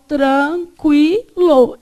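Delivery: "tranquilo" slowly broken into syllables. Shown as a demonstration. Teacher recaps the separation for the class, syllable by syllable. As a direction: split "tranquilo" as tran, qui, lo with pauses. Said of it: "como é que você separou?".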